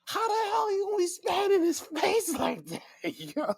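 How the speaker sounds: high, slowish mock-outraged voice; exasperated rant. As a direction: scold him in high disbelief. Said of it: "I'm like".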